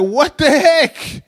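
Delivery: high, slow, drawn-out exclamation; shocked dismay. Retold or imitated. Man seeing his oil all over the floor, high shocked dismay. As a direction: stretch it out in acted disbelief.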